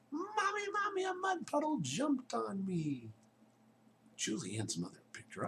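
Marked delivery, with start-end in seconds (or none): 0.1-3.1 put-on high, panicked little girl calling mommy; child yelling for mommy. Little girl runs inside yelling to her mother, high and panicked. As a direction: cry out for mommy like a scared child. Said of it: "Julianne ran inside yelling".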